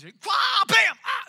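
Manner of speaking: high, loud "Quah, bam, ah!" as hits and a yelp; mock fight noises. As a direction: act out the nunchuck smacking yourself. Kids hurting themselves with nunchucks.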